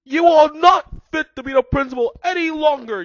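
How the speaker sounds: high, very loud screechy yell. A narrator's reading. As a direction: yell it at the top of your lungs. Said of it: "yelled Rumbridge".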